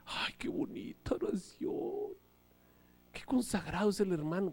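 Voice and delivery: soft whispered awe; admiring whisper. Retold or imitated. Admiring churchgoer whispers praise of the prayer. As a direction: breathe it out in awe.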